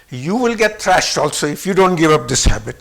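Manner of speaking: usual speaking voice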